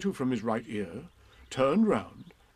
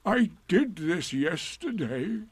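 slow, lightly voiced donkey, a little higher; Eeyore; 6 semitones higher, slower than usual. A narrator's reading. Eeyore explains his balancing, slow and deliberate. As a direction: explain it slowly and ponderously. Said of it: "he explained".

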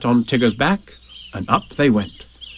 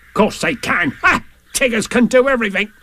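higher, bouncy character voice; raised, blustery animal character; Tigger's voice; 11 semitones higher than usual. Acted. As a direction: bluster it like a confident braggart. Tigger boasts to Roo.

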